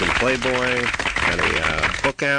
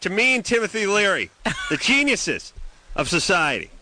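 high, ringing rant to the audience; speechifying in a raised pitch; mock motivational speech; 9 semitones higher than usual. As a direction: deliver a mock lecture, pitched well up. Delivers a rousing mock speech.

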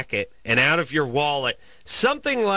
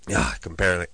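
hoarse voice; 9 semitones lower, much faster than usual. Real, not acted. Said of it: "I can't even talk".

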